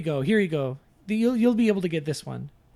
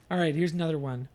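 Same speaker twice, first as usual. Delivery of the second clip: ordinary talk, nothing marked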